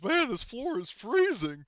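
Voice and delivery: high, slow, loud panicky marine voice; Hudson impression. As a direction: whine it like a scared space marine. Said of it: "Remember Hudson's".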